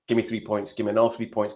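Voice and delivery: ordinary talk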